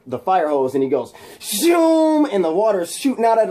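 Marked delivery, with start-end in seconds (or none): 1.4-2.3 high, long whooshing zoom; imitated water blast. Imitates fire hose spraying.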